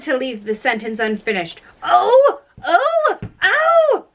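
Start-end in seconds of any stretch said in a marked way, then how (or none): 1.8-4.1 high, piercing cries of pain, climbing; screaming. A narrator's reading. Queen shouts over Alice, screaming in high bursts. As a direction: shriek each "oh" like a steam whistle. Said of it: "began screaming so loud".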